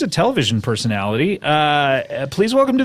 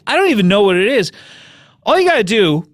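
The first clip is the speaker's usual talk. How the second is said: ordinary talk, nothing marked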